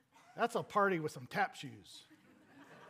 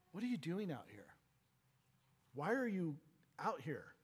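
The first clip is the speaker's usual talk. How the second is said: softer, gently coaxing father; father gently pleading with his son, softly; gentle pleading; 5 dB quieter than usual. Acted. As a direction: coax the son inside, softly. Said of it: "he gently pleaded with his son".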